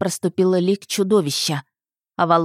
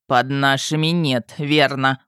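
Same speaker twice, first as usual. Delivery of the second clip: lower, slow smirking concession; wry husband; 4 semitones lower, slower than usual. A narrator's reading. Husband baits the dragon father, wry lower voice. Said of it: "усмехнулся Авалон".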